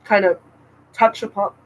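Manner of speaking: talking through hiccups; speech broken by a hiccup. Real, not acted. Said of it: "I have the hiccups".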